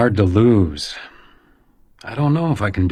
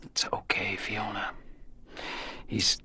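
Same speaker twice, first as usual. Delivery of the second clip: hushed comforting whisper; calm her, barely voiced; 13 dB quieter, slower than usual. Becker whispering to Fiona that the man is gone.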